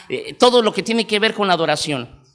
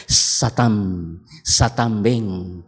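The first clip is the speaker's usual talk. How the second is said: very low imitation of demonic pleading; possessed girl; 11 semitones lower, 4 dB quieter, much slower than usual. Preacher imitates possessed girl calling on Satan, low demonic plea. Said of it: "decía así literalmente".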